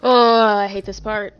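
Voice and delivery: loud, slow, desperate; being chased. Real, not acted. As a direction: wail it out in dread. Still fleeing the monster.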